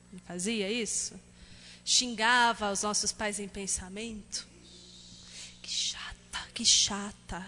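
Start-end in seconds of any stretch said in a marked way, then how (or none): 5.6-7.4 whispered "que chata"; child's whispered grumble. Retold or imitated. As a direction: whisper it behind mom's back. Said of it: "maldizer em voz baixa". (sussurrando como criança emburrada)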